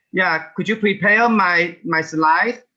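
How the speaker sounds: normal talking voice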